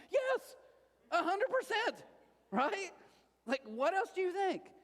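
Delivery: high, loud mocking impression of the wife; wife's mock answer. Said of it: "And she went".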